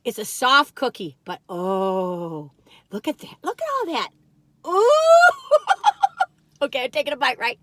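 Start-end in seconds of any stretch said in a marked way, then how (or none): 5.0-6.2 high, loud burst of laughter; shrieking happy laugh; delighted laugh. Real, not acted. Delighted at the cookie.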